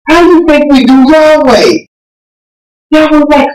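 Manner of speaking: ordinary talk, nothing marked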